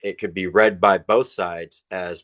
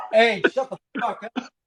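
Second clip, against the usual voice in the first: turned away, yelling high at the dogs; shouting at dogs; 13 semitones higher than usual. Real, not acted. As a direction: snap it hard at barking dogs. Yelling at fighting dogs in garage.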